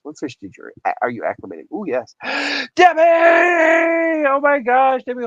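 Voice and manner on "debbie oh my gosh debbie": high, loud cry of a friend's name; delighted shout. Real, not acted. Greeting Debbie in chat, a loud delighted shout.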